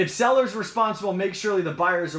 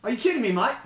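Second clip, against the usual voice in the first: incredulous, higher-pitched mock Aussie; put-on Australian disbelief, rising at the end; Australian accent; 4 semitones higher, much faster than usual. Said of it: "I'll try to do an Australian".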